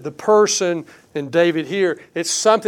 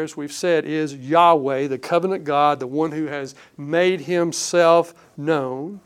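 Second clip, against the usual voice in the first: ordinary talk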